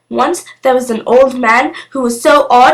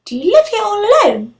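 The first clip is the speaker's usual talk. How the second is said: put-on high, worried little girl asking; a small, fearful voice; anxious child's question; 9 semitones higher than usual. A narrator's reading. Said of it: "and Anika asked anxiously".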